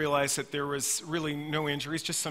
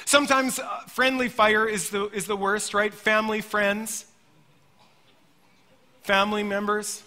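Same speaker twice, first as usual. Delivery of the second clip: ordinary talk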